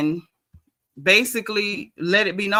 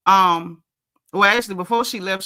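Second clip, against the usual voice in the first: ordinary talk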